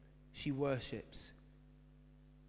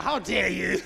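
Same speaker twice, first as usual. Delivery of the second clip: mock offense; 3 semitones higher, 9 dB louder than usual. Mock offended dog lovers.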